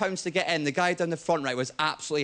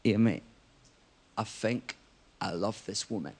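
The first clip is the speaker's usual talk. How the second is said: put-on low, soft, slurring drunk sincerity; bleary-eyed drunk mate; 10 semitones lower, 6 dB quieter than usual. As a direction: mumble it like a soppy drunk friend. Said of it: "bleary-eyed, he's like".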